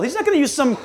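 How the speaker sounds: ordinary talk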